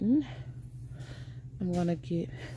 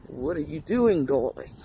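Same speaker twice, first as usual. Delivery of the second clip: lower, throaty drop on darling; low put-on voice; 4 semitones lower, 3 dB louder than usual. Vlogger repeats the greeting in a low put-on voice. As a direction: bring it down low and sultry-teasing. Same line redone low.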